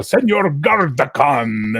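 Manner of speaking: mock medieval. Quoting the comedy skit, mock-medieval pomp. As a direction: draw out the name, spoofing serious gamers. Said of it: "make them sound medieval".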